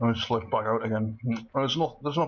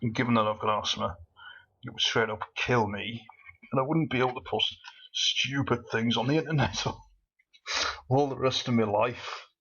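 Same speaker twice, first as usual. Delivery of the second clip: ordinary talk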